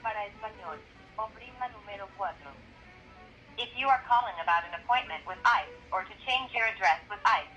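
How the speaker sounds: recorded phone menu over the line; automated hotline recording. Real, not acted. Automated ICE tip line call.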